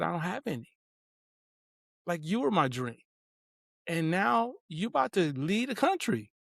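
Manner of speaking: soft, pleading retold confession; understated plaintive quote, softly spoken; mildly tearful retelling. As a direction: retell a tearful dad's admission, hushed. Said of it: "I'm in the car and I'm crying".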